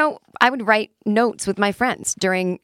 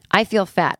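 "feel" leaned on hard, louder; stress on feel; 4 dB louder than usual. Shown as a demonstration. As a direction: punch the word "feel" in "I feel fat". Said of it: "I emphasize the word feel".

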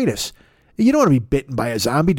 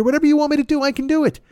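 much higher, eager and bright; eager pitch; 11 semitones higher, faster than usual. Acted. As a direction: sell it eagerly. Eager Cameo sales pitch.

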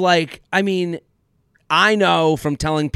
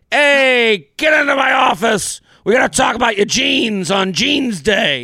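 gruff boss yelling; 5 semitones higher than usual. Pretend. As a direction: yell like a big cigar-chomping boss.

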